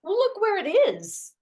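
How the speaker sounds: high, loud indignant outburst; exasperated. Acted. Complaining to her friend about the stranded thermos, high indignant. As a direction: point it out in frustrated disbelief.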